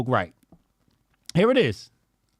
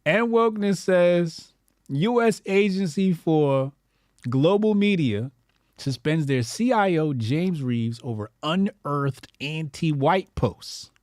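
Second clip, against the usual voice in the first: slow, higher headline voice; mock news read; 3 semitones higher, slower than usual. Shown as a demonstration. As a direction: read it like a solemn anchor. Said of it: "I can't even read this shit with a straight face".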